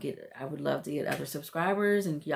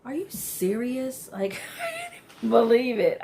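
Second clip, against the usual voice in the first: louder, higher, half-laughing in disbelief; 7 semitones higher, 5 dB louder than usual. Real, not acted. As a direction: laugh through the shock. Stunned by husband's behavior.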